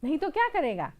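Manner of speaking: loud, challenging tailor; angry tailor. Acted. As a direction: throw the challenge back. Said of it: "दर्जी ने गुस्से में कहा". (गुस्से में दर्जी की ललकार)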